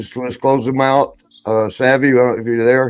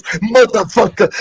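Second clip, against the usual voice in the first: slow, much higher mock cursing; Sheik's curse; 10 semitones higher than usual. Sheik cursing at Paul, slow and much higher. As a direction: spit it out slowly, high, like the outraged Sheik. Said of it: "He goes".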